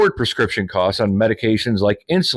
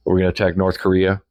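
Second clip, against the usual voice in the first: ordinary delivery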